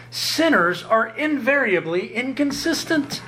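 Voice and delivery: high, slow, every word weighted; slow emphatic repeat. Shown as a demonstration. Preacher repeating the verdict slowly so it sinks in. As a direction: spell it out slowly.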